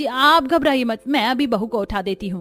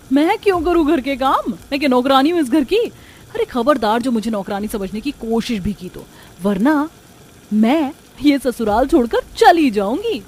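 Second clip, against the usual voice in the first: angry; 3 semitones higher, 4 dB louder than usual. Acted. Bride refuses chores, threatens.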